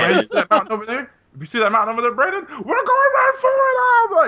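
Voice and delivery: high, loud, building to a shout; increasingly manic high-pitched mimicry; mock reckless pilot. Mimics Alex crashing the plane.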